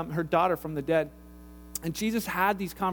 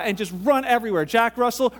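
ordinary delivery

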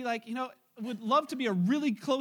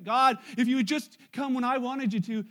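loud, mock reproach to God; acted complaint; 7 dB louder than usual. Believer blaming God for coming late, in loud acted complaint. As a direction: complain upward with force.

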